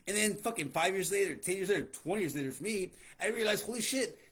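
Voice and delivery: usual speaking voice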